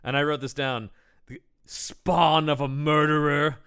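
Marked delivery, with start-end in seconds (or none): 1.3-3.6 slow, drawn-out melodramatic delivery; theatrical insult. Retold or imitated. Bullies throwing an insult at Hans, slow theatrical contempt. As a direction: stretch it out like a damning accusation.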